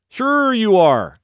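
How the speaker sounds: slow, loud, high sarcasm; mocking doubters. Everybody doubting David's boast, loudly sarcastic. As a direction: stretch out SURE in a high, mocking swoop. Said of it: "And everybody's like".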